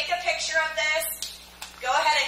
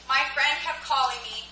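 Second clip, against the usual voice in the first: ordinary talk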